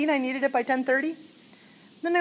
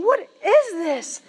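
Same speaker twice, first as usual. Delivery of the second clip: awed question; 4 semitones higher, much slower than usual. Retold or imitated. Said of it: "And I'm looking at this going".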